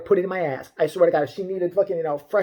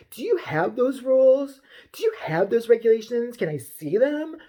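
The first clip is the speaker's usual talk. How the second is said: higher, slow, demanding customer voice; hammed-up affronted shopper; mock entitled Karen; 6 semitones higher than usual. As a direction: drag out each challenge in mock indignation.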